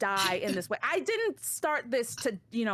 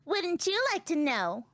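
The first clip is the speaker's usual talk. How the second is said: put-on high, haughty little-girl voice; sassy child character; 7 semitones higher, slower than usual. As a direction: play her as a smug, secretive brat. Child taunts the soldier.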